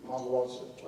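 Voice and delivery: usual speaking voice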